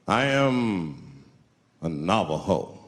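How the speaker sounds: low, slow grave reply; lightly stoic man. Retold or imitated. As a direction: answer with quiet dignity. Stoic man states his tribe.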